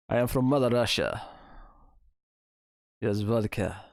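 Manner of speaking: weak attempt at a Russian accent; a half-hearted Russian accent, barely there; bad Russian accent. Streamer jokes about moving to Russia in a bad Russian accent. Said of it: "I can't do a Russian accent".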